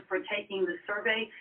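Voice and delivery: normal talking voice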